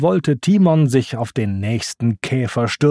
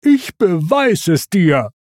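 slightly raised, hurt protest; lightly whiny defiance; Pumba's wailed vow; 5 semitones higher than usual. A narrator's reading. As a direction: insist with a touch of a wail. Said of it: "heulte Pumba".

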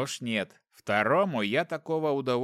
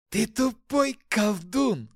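high, slow hissing demon; demonic hiss; 9 semitones higher, 4 dB louder, slower than usual. Acted. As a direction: hiss the insult slowly. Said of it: "прошипел демон".

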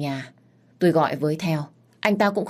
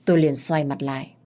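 ordinary delivery, nothing marked

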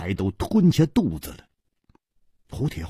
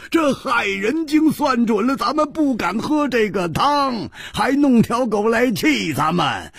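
shouting; 11 semitones higher than usual. Acted. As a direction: yell it out, slamming the table. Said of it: "大叫道". (胡铁花拍桌大叫)

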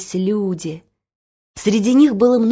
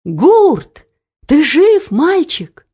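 loud, raised cry of disbelief; astonished shout; 7 semitones higher, 6 dB louder, much slower than usual. A narrator's reading. Someone in the crowd finding the boy alive, shouting. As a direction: call out over the crowd. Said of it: "Кто-то закричал в толпе".

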